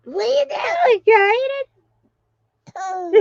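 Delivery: put-on high, slow baby-talk voice. Parent voicing to baby.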